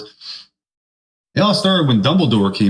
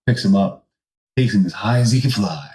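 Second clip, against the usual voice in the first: ordinary talk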